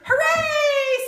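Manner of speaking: put-on high, loud children's cheer; cheering kids. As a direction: shout it like delighted schoolkids.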